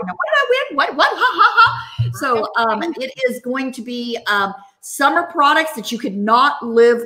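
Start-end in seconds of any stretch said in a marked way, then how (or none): 0.1-2.1 squealing high with giggles; giddy high-pitched "what did I win" with laughter; excited winner. Retold or imitated. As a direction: play a thrilled winner laughing. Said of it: "We've already got people going".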